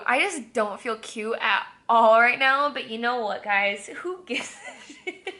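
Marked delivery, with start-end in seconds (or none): 4.0-5.3 very high, slow, laughing; laughing shrug. Real, not acted. Telling viewers she does not care, giggling high and slow. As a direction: drag it out through a giggle.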